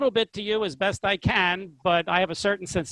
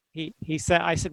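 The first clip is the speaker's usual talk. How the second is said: ordinary delivery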